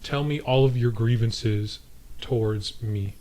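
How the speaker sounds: soft, caring coax; tender, soft let's-talk voice; gentle concern. Pretend. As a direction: quietly invite her to open up. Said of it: "I just say to her".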